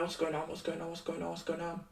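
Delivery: a quiet spiral of panic; hushed panic. Retold or imitated. Mimicking her drunk self seeing the police, hushed panic.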